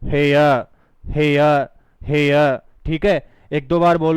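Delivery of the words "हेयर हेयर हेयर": हेयर said louder, as a clear "hea" to copy; repeat-after-me drill. Shown as a demonstration. Teacher drills हेयर loudly for students to echo. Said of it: "जैसे जैसे मैं कहता हूँ वैसे वैसे करना पड़ेगा".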